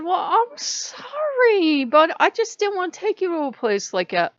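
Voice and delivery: high, loud, stretched-out mock apology; hammed-up defensive sorry; exaggerated apology. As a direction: plead it loudly in a high voice. Acting out apology to gods.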